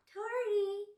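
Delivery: very high, put-on influencer name. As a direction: announce yourself as a mock influencer. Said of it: "influencer voice".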